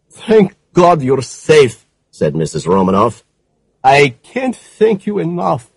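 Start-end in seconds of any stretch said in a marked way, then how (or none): 0.1-1.9 higher, slow, relieved woman; a hint of a grateful widow. A narrator's reading. Widow on phone. 3.8-5.7 a hint of a grateful widow; relieved widow. A narrator's reading. Widow on phone.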